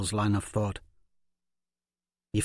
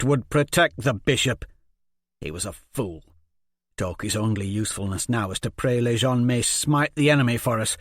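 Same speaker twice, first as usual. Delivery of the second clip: angry bitter rant; 5 semitones higher, 6 dB louder than usual. Acted. Jenko rants against the bishop.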